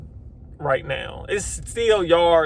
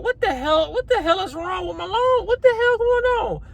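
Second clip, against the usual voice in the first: much higher, retelling his panicked disbelief; alarmed disbelief; 14 semitones higher than usual. Retold or imitated. Mowing a customer's lawn, reliving the jam to viewers, alarmed high disbelief. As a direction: pitch it way up like he can't believe his mower. Mower blade jams on something.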